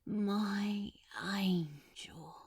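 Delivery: very slow, soft whisper. Acted. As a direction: whisper it slowly, utterly content. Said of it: "hissed softly with utter contentment".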